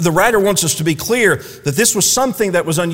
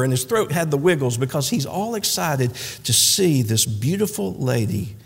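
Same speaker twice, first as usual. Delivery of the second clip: ordinary talk, nothing marked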